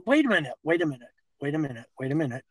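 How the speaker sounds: rapid-fire repeated interruption; fast, insistent halting; hurried interjection. Real, not acted. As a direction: rattle it off quickly to hold the floor. Cuts in to halt objection.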